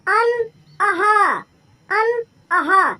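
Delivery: "अन, अह" drawn out very slowly; slow vowel demo. Shown as a demonstration. Teacher sounding out "अन, अह" very slowly for students. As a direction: spell out "अन, अह" syllable by syllable. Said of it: "इस तरीके से".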